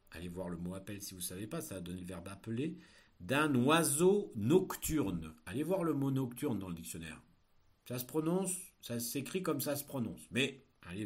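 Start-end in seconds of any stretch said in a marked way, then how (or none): 3.2-5.3 slow, loud dictation; dictating. Shown as a demonstration. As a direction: read it out for pupils to write. Teacher dictating a text.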